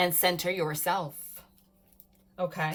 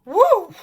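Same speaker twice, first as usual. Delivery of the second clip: loud high cheer; excited whoop; 12 semitones higher, 16 dB louder than usual. Real, not acted. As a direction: whoop with delight. Celebrating card.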